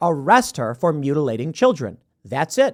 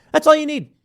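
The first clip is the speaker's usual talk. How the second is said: usual speaking voice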